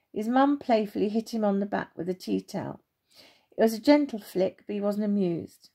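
ordinary delivery, nothing marked

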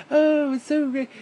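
high, slow, gushing fake-cheerful voice; sneering parody of a bubbly YouTuber; mock YouTuber gush. Ranting vlogger mocks YouTubers with a slow gushing voice. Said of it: "they're like".

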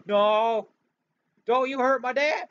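gasp, then a high, loud protective yell; a son screaming to protect his father; frantic shout. Acted. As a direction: shout to stop them hurting your dad.

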